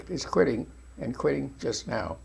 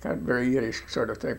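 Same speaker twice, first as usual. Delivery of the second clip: ordinary talk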